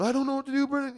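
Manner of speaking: high, desperate plea; a panicked cry of helplessness; retold helplessness. Holding his unresponsive friend, pleading in desperation. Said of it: "I'm going".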